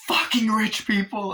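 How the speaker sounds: high, slow, loud acted disgust; hammed-up exasperated rage; bitter fury. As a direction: curse them after the outburst. Acts out woman raging at driver.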